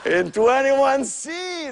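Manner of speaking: breathy giggle spilling into words; can barely get it out for laughing; slow, giggling mock gossip. Attendant laughing about the passenger.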